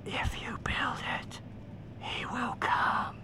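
slow, high, breathless whisper from nowhere; ghostly whisper. Retold or imitated. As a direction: breathe it like a disembodied voice. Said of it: "the disembodied voice that goes".

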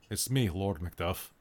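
slow, low Scottish lord. Shown as a demonstration. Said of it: "trying to go a bit deeper".

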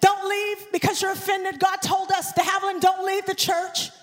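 ordinary talk

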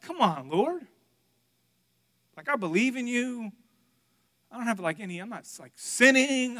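high, exasperated protest; ticked-off pleading. Retold or imitated. Dad pleading with God over bad results, ticked off. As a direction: argue your innocence, building to a loud finish. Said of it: "I was so mad, like, ticked off".

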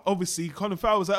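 ordinary talk

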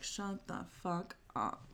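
slow, hushed, fed-up retort. Retold or imitated. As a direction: imitate someone fed up, spacing each word quietly. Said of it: "She's just like".

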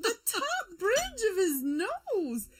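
squealing through laughter; high, giggly disbelief; laughing. Real, not acted. Reacting to spaghetti face photo.

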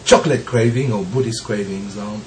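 ordinary talk, nothing marked

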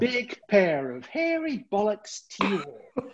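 high, slow, stretched announcer delivery; mock ship announcement. Crewman insulting everyone on board over the tannoy, mock grand announcer voice. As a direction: draw each word out in a lofty tannoy voice.

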